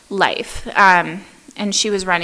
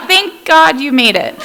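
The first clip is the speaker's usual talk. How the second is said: relieved greeting; 6 semitones higher, 7 dB louder than usual. Retold or imitated. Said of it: "They were like".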